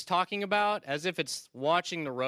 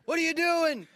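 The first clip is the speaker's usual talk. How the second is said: high, alarmed cry from the wife; mock panic at the husband's wrong turn; 10 semitones higher than usual. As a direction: squeal it like a panicked passenger.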